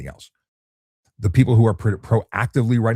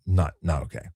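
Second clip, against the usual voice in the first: normal talking voice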